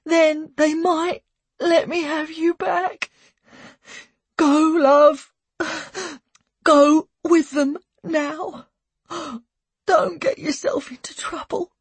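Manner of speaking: tearful mother. A narrator's reading. Mother begging her son to go to foster care, tearful. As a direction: plead through tears, in halting phrases.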